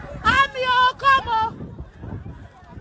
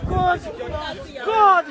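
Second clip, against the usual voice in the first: anguished cries; 6 semitones lower, slower than usual. Real, not acted. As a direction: cry out in anguish.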